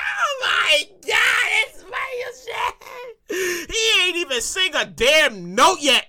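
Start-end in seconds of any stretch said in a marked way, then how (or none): 0.0-3.7 high, drawn-out tearful wail; hammed-up starstruck sobbing; crying and screaming fan. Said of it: "Everybody all crying and screaming".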